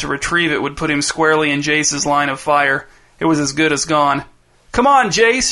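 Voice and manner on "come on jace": put-on high excited kid's shout; Dez shouting. A narrator's reading. Dez calls his brother to attack, high shout. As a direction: call out to your brother eagerly. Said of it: "shouted Dez".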